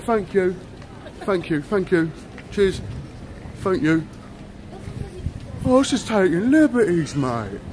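ordinary delivery

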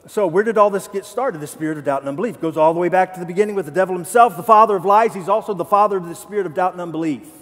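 loud, fast preaching over music; fast, loud pulpit build; full-force preaching. Real, not acted. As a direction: preach it, rising to a cry.

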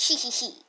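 mischievous hee hee hee giggle; sneaky giggle. Acted. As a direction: giggle like a little schemer. Gloating over the revenge plan.

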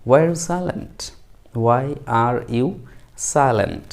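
very slow, words spaced apart; slow model sentence. Shown as a demonstration. Teacher modelling the question for learners, very slow. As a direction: drill it slowly twice. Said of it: "ইংরেজিতে বলবেন".

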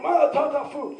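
high-pitched gibberish shouts, imitating a crowd; mock Hebrew yelling. Rioters yelling at each other in Hebrew, high mock yell. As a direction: mimic a violent-sounding Hebrew yell. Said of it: "Everyone's yelling in Hebrew".

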